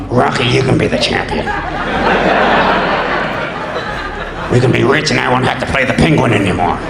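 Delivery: low slurred Stallone as Rocky; Stallone impression. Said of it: "Tommy Davidson's Stallone impression".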